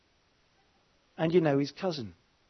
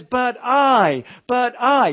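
"I" hit high and loud, both times; stress on I; 8 semitones higher, 10 dB louder, much slower than usual. Acted. God answering the prophet's excuse, loud stress on I. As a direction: cut in forcefully, punching the word "I". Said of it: "And God says".

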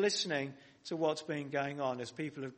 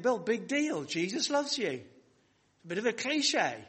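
higher-pitched, lightly scoffing sceptic; mock dismissive; 6 semitones higher than usual. Preacher voicing a sceptical listener, mock dismissive. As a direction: shrug it off as old news.

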